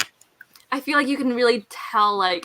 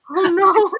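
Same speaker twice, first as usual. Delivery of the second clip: higher, louder mock alarm; mock dismay; 7 semitones higher, 8 dB louder than usual. Imitating her own worry over Kat's reread, mock dismay. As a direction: act out the dread of a friend's reread. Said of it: "and I was like".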